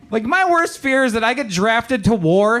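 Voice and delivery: normal talking voice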